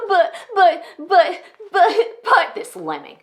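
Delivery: high, loud mimicked sputtering; mock his excuses in an exaggerated stutter; mocking stammer. [name] mimics her executive pastor's reasons, mocking.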